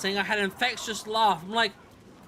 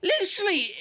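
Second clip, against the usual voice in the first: ordinary talk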